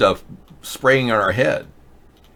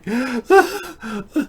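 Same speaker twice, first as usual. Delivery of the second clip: mock sob; 13 semitones higher than usual. Mock sob ends rant.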